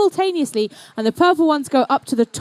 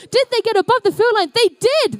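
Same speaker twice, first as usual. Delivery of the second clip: high, rising thrill at the buzzer; real excitement as the teams make it; excited; 8 semitones higher than usual. Real, not acted. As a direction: call it like the crowd's on its feet. Last-second scoring in the match.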